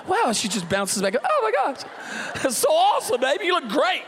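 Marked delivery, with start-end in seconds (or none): 0.1-0.3 high, laughing mock wife voice. Wife shrugging off her fall, high and laughing. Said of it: "She's going". 1.2-4.0 high, laughing, gushing praise; gushing admirer. Retold or imitated. Onlookers cooing at the wife after her fall, high and laughing.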